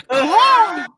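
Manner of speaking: high, hoarse bird caws; wheezing out caws from exhaustion; gasping caws. Acted. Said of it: "I'm huffing and puffing".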